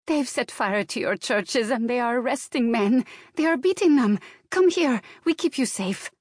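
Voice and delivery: put-on high, urgent Slavic-accented report; alarmed Slavic accent. A narrator's reading. Maid reporting arrests to her employer, alarmed Slavic accent. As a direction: plead with him in a thick Slavic accent. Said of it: "her strong Slavic accent".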